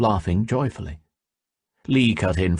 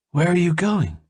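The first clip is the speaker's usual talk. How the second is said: high, anxious question; worried she is leaving him behind; mildly anxious call after her; 11 semitones higher than usual. A narrator's reading. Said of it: "Gui asked anxiously".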